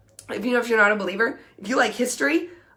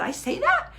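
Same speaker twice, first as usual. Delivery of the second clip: shocked; 10 semitones higher than usual. Retold or imitated. Shocked at girlfriend's remark.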